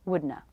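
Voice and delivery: wouldna as WOOD-nuh; soft wouldna demo; reduced wouldna. Shown as a demonstration. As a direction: drop not, N then schwa. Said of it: "and wouldn't have".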